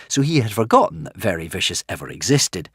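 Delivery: plain reading voice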